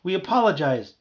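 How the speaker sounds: high, loud whine; whining. A narrator's reading. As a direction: whine out a defensive apology. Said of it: "Barry whined".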